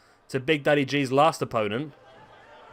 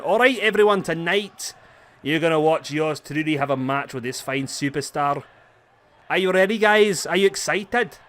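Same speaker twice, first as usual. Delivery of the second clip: mock excitement; 3 semitones higher than usual. Wrestler teasing the fans about a match, mock excited. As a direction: hype them up, insincerely. Mock hype before saying too bad.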